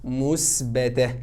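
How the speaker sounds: مثبته drawn out low and slow; teacher modelling answer. Shown as a demonstration. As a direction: give the answer slowly. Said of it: "يبقى الجمله دي ايه يا ولاد؟".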